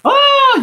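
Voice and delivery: loud, high-pitched drawn-out "ah"; mock ecstatic moan. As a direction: cry out high and long, in mock climax. Mimics a release moan.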